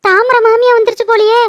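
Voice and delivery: usual speaking voice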